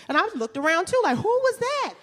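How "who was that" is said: squealing high nosy bystander, laughing through it; high gossipy onlooker voice. Retold or imitated. Gawking bystanders at the breakup, squealing high.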